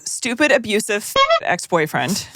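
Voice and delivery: ordinary talk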